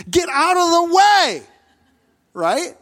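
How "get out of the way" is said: loud, high, drawn-out angry yell. Acted. Anxious father yelling at the crowd to clear a path, angry. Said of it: "Jairus is just angry".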